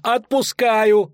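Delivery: high, drawn-out wail; panicked howl. A narrator's reading. Poet hastily forgiving his executioner, howling. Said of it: "взвыл Лютик".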